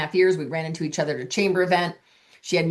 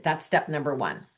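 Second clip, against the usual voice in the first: usual speaking voice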